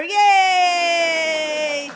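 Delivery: loud hammed-up cheer; bright showbiz yay; mock cheer. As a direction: celebrate with the crowd.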